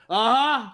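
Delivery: loud, high mock "uh-huh"; dismissive "uh-huh". Actor on a plane shutting down a fan, loud "uh-huh". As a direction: blurt it to end the conversation. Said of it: "he responded by saying".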